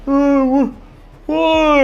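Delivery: a huge hulk sounding small and confused; simple brute. Acted. The brute Big Ed answers.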